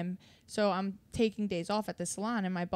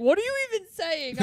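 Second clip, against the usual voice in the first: high, louder mocking coworker voice; mock confused coworker; 10 semitones higher, 4 dB louder than usual. Mocking coworkers who could not understand her accent. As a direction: mimic the baffled salon staff, up high. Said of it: "And they'd be like".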